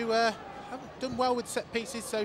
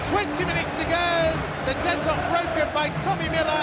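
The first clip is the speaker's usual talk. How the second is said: excited goal call; 5 semitones higher than usual. Real, not acted. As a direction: yell the scorer's name. Goal call in live commentary.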